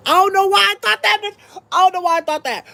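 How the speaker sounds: high-pitched squeal of disbelief; comically high, dismayed outburst; mock disbelief. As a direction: squeal it, baffled at yourself. Incredulous at own panic in story.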